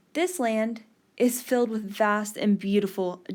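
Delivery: ordinary delivery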